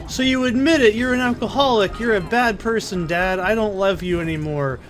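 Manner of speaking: high-pitched mock outburst of an upset kid; imitated childish indignation. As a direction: accuse Dad like a distraught little kid. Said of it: "I'm, like, actually in tears".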